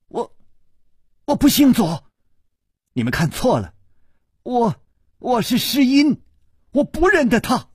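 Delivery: high-pitched frantic woman's cries; crying out. A narrator's reading. Miss Zuo denying who she is, frantic cry. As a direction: call out high in broken, panicked bursts. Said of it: "左姑娘忽然大叫".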